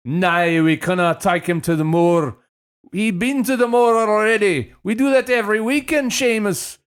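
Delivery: hammy botched Irish-sounding parent; bad Scottish-Irish accent. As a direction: attempt Scottish and slide into Irish. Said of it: "That's Irish".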